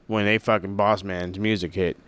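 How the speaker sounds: normal talking voice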